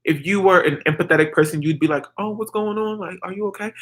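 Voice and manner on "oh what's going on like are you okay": soft, slightly raised, caring voice; acted hushed check on someone; gentle concern. Empathetic person responding to the soft-spoken girl, gentle concern.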